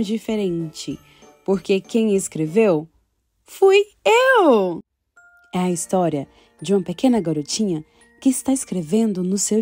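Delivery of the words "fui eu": proud reveal. Acted.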